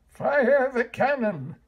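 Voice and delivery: high, loud order; mayor ordering the men to fire; commanding yell. A narrator's reading. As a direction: bark it like a frantic mayor. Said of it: "yelled the mayor".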